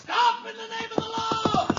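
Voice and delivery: high ringing shout after the sleigh; shouting stop. Acted. Cop chasing a runaway sleigh, shouting. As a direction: yell it from down the street.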